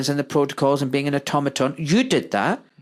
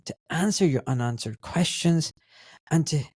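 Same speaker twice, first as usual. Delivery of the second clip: lighter, softer host-read plug; podcast ad read; 4 dB quieter than usual. Acted. Podcast host breaking in to advertise his consultation service, ad-read tone. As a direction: pitch the service to listeners, friendly and brisk. Host reads own sponsor ad.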